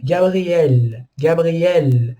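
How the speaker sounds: Gabriel with the stress on the final -el; stress on last syllable. Shown as a demonstration. Teacher showing students where Gabriel is stressed, final syllable stressed. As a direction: land on the end of Gabriel. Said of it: "a última sílaba é a tônica".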